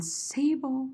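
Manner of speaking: high, drawn-out "sable"; high pitch. Shown as a demonstration. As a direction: lift SABLE up high and linger on it. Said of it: "use high pitch too much".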